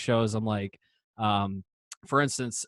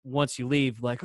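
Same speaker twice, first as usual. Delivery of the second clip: ordinary talk, nothing marked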